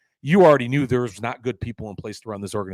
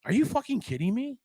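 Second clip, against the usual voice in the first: high-pitched, softer disbelief; incredulous; 11 semitones higher, 5 dB quieter than usual. Real, not acted. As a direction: pitch jumps way up in real incredulity. Outraged at the denial.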